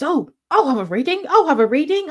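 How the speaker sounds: high, eager imitated volunteering; Nigel piping up. Man volunteering for a tarot reading, high mock-eager voice. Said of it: "decides to pipe up and he goes".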